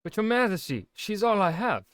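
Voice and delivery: a pleading shepherd begging his king. Acted. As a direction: beg the king for your daughter.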